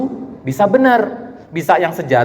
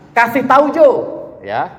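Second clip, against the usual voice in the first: put-on playful Manado accent shout; 7 semitones higher than usual. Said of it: "ini pakai logat Manado nih".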